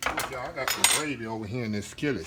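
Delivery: normal talking voice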